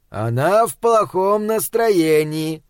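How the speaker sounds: ordinary delivery, nothing marked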